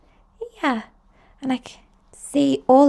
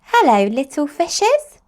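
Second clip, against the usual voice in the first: lightly voiced, louder and higher cheery hello; a hint of a friendly mum greeting the fish; Mummy Pig greeting; 5 semitones higher, 6 dB louder than usual. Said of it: "says Mummy Pig".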